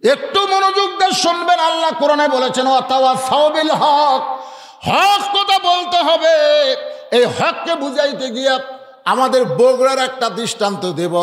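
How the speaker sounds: high, stretched-out preaching chant; intoned fiery preaching. Real, not acted. Preacher urging the crowd to speak the truth, intoned preaching. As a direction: call it out high, drawing out the phrase ends.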